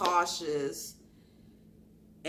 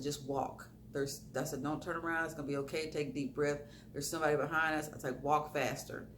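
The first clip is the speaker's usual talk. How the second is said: low, very soft undertone; hushed, secretive reassurance, acted out; retold hushed warning; 5 semitones lower, 10 dB quieter than usual. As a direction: re-enact quietly warning a friend of danger. Secretly warning friend of a follower.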